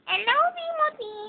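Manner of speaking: very high, soft cartoon voice; a toy talking to the baby; squeaky toy voice. A mother voicing Mickey Mouse for her baby, squeaky and high.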